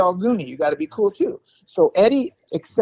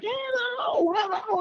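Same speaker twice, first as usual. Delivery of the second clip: high, soft mock rock-star mic call; 6 dB quieter, much slower than usual. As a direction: croon it up high like a singer warming the mic. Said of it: "Rod goes up to the mic, and he's like".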